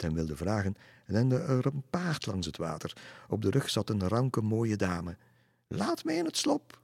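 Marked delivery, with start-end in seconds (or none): 5.7-6.8 very high, put-on lady's shriek; crying out. A narrator's reading. Lady on horseback demands entry to castle, crying out. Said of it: "riep ze uit".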